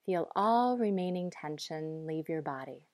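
ordinary delivery

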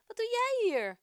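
high, loud delighted question; glad cry. Acted. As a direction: shout it out, thrilled to see him. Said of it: "roept hij blij".

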